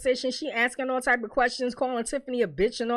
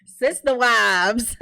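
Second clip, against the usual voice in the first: slow punchline; 5 dB louder, much slower than usual. Real, not acted.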